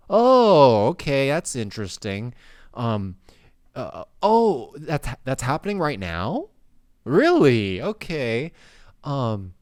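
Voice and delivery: high, loud playacted naive questions; mock naive questioner. Pretending not to understand inflation, high mock voice.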